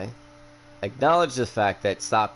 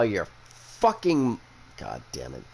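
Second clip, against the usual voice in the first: ordinary delivery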